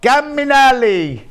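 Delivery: high, slow sing-song call to the dog. Real, not acted. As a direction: stretch it out, calling a pet. Calling the dog in Italian.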